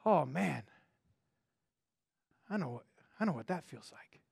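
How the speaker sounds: soft, low, sorry and understanding; tender comfort. Pretend. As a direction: speak it quietly like consoling a friend. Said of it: "can say to you".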